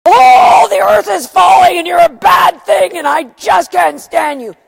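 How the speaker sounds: loud, high, overblown scolding shout at the dogs; mock exasperated yelling. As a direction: blow up at the dogs as if the world is ending. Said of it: "is it a".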